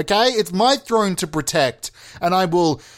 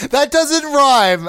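high, loud and drawn out; stretched, raised-voice outburst; loud exclamation; 9 semitones higher, 6 dB louder, much slower than usual. Real, not acted. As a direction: cry it out in exasperated protest. Exclaims at own bad example.

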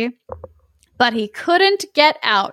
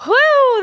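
relieved whew; 4 dB louder than usual. Real, not acted. Relief after long reading.

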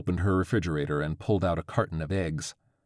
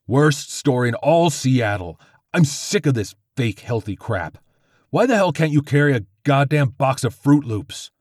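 angry yelling; 6 semitones higher, 7 dB louder than usual. Acted. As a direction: rage at the clerk. Angry bald man yelling at clerk.

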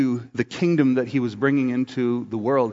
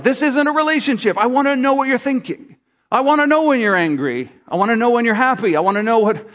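higher-pitched insistent appeal; frustrated plea; 7 semitones higher than usual. Acted. Partner to someone who never talks, frustrated plea. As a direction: plead to really know the other person.

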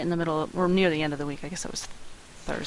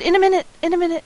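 put-on whiny kid; 12 semitones higher, 7 dB louder, much faster than usual. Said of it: "I still had".

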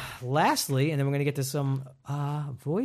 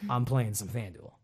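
while sipping a drink; 4 semitones lower, 5 dB quieter than usual. Real, not acted. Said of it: "I'm just drinking from my Bad for Business podcast coffee mug".

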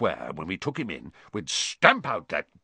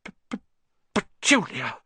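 stammering gasp bursting into a higher cry; horrified stutter; 9 semitones higher than usual. A narrator's reading. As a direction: choke on the first sound, then get the name out. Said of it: "he gasped".

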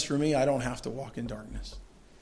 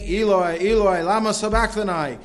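raised, higher voice crying out to God; loud cry; 5 semitones higher, 6 dB louder than usual. A narrator's reading. Reading Jesus's cry from the cross, loud cry. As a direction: cry the words out in anguish. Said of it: "Jesus cried out with a loud voice".

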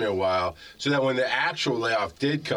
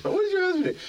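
very high mock voice for the nosy question; mocking nosy parent; faster than usual. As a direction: squeal it like a prying parent. Mocking the dumb question again.

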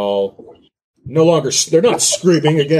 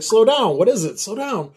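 put-on mother to child; 11 semitones higher than usual. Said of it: "it's like".

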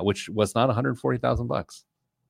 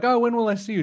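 high pleading self; 12 semitones higher, faster than usual. Retold or imitated. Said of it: "I'm like".

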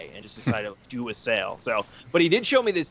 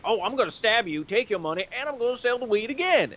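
higher, menacing mock dealer voice; hammed-up dealer threatening a buyer, higher; thug dealer; 9 semitones higher than usual. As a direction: threaten him in a pitched-up hammy voice. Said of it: "sometimes he was like".

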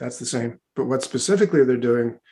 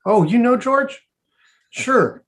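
high, loud, playful astonishment; jokey raised-pitch exclamation; mock surprise; 11 semitones higher, 6 dB louder, slower than usual. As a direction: pretend to be thrilled at a mutual acquaintance. Joking reply to a made-up name.

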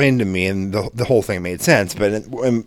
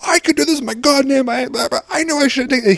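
high-pitched ranting meltdown, imitated; losing his shit. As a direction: imitate someone losing it in a high, frantic rant. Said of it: "just losing his shit in this truck".

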